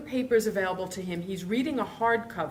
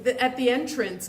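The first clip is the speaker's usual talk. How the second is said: ordinary talk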